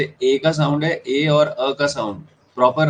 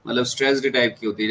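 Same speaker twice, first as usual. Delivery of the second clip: usual speaking voice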